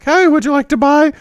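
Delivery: teen boy imitating his unbroken girlish voice; girlish phone voice. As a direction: chirp the sales line like a young girl. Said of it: "mistaken for a woman all the time on the phone".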